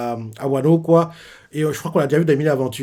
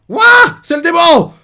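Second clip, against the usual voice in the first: far higher and louder cry; alarmed yell; 10 dB louder, slower than usual. Retold or imitated. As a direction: shriek it out as the demon appears. Character cries out at demon.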